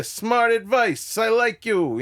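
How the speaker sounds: high, slow, emphatic approval; Andrew Dice Clay. Retold or imitated. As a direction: imitate a brash comic giving his blessing. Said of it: "He'd go".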